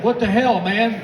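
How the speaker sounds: Jerry caught off guard, blurting it out; startled outburst. Retold or imitated. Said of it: "my buddy Jerry's like".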